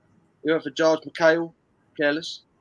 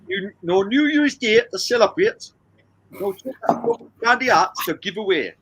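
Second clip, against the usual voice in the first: bad Geordie accent, pitched up and loud; 6 semitones higher, 5 dB louder than usual. Shown as a demonstration. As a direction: put on a hammy, higher-pitched Geordie. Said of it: "I've got to do it in a Geordie accent".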